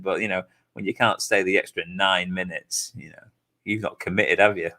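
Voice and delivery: low, soft mock-reproach; mock disappointment. Host grumbling about the guest leaving, mock disappointment. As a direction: sink low as if let down.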